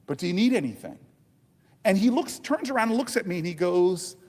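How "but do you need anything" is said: low, polite apology to a stranger; gently deferential, pitched down; lowered, respectful tone. Retold or imitated. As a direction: lower your voice and ask respectfully. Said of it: "so I lowered my tone".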